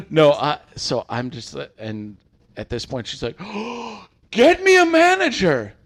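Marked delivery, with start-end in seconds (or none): 3.4-4.0 quick shocked breath in; mock gasp. Customer about to demand a manager, mock gasp. 4.3-5.7 much higher, loud order; mock demand. Entitled customer demanding a manager, loud and high.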